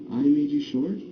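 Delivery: sorry, hurt little question; felt guilty question. Shown as a demonstration. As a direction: really feel the guilt. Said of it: "you wanna really feel that".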